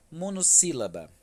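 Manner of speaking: "Monossílaba" dito mais alto, anunciando o tópico; anunciando em voz alta. Shown as a demonstration. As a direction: apresente a palavra "Monossílaba" com força. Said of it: "tem apenas uma sílaba". (anunciando o tópico em voz mais alta)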